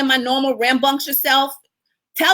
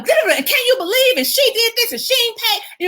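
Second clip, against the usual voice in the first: much higher, slowed, exasperated complaint; outraged venting; 12 semitones higher than usual. Retold or imitated. Ranting to her attorney about an unpaid bill, much higher. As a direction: vent to the lawyer like it's a crisis. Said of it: "D T, I will call him".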